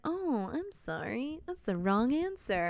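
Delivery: slow, fake-sympathetic taunt; mock sorry. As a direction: drawl it like a gloating host. Killer taunts wrong answer.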